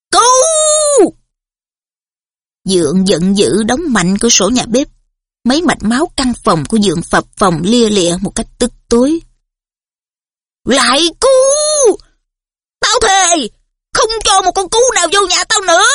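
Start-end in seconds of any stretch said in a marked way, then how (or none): 0.1-1.1 very high bellow; roaring yell. A narrator's reading. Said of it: "Dượng Vernon gào lên". 10.6-15.8 furious rant. A narrator's reading. Uncle raging at owls.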